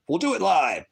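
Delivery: a hint of a ranting anchor; mock newsman rant. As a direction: throw it out like a famous rant. Quoting famous line in put-on voice.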